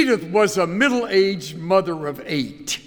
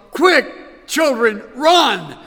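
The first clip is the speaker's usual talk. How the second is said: higher, urgent cry of alarm; mild panicked scream; 4 semitones higher, 4 dB louder, slower than usual. A narrator's reading. Panicked mother shouts to her children among baby skunks, mild scream. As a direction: call out in alarm, short of a full scream. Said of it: "She screamed".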